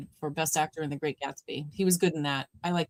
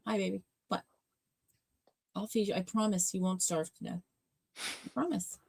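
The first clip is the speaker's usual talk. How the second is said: soft cooing to a pet; 6 dB quieter than usual. Real, not acted.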